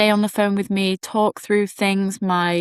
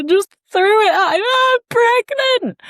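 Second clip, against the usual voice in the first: hysterical tears; 6 dB louder than usual. Retold or imitated. Throwing the baby grow at husband, tearful cry. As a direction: blurt it out in floods of tears. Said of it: "in hysterical tears".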